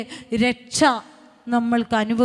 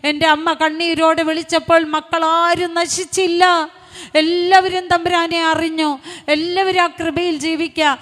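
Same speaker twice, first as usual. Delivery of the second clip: loud, raised-pitch sermon cry; impassioned preaching; 4 semitones higher, 6 dB louder than usual. Real, not acted. Preacher telling believers nobody will perish, impassioned full-force preaching. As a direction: proclaim it forcefully to the whole congregation.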